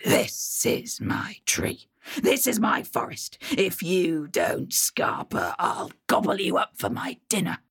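a troll hissing a warning from the branches; whispering troll. Acted. Troll threatens again.

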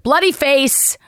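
half-mocking alarm; mock panic. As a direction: blurt it out like a flustered bystander. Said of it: "I'm just like".

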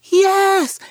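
put-on high, loud, drawn-out little-kid scream; child's screamed yes. Little girl answering Nana about her gift, screaming. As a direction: shriek it like an eager small child. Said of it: "because she's screaming".

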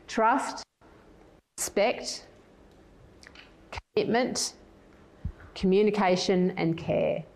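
slow, spaced-out list so listeners can write it down; slow, for note-taking. Shown as a demonstration. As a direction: list them slowly with long pauses between items. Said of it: "I'll say them slowly".

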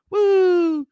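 high, loud siren "woo"; one long, high siren wail, sung out by mouth; imitated siren. Storyteller mimicking their small boat's lone blue light, high loud siren noise. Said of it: "we got one blue light going".

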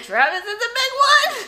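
put-on animated high child voice; high little-boy voice rising at the end; Michael. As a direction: mimic an excited kid proudly calling out. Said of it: "Michael's so animated".

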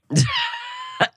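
short high-pitched burst of laughter; sudden high laugh. Real, not acted. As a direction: crack up in a quick high laugh. Amused burst over sriracha.

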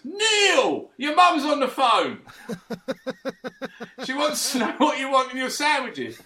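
mock shout. Fan in the North Bank heckles the keeper in a mock shout. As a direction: jeer at the keeper from the stands. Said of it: "kept shouting".

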